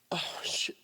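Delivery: a whispered aside, breath only; whispered oh shit, caught off guard. Real, not acted. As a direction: drop to a whisper, overwhelmed. Overcome mid-share, breaks off.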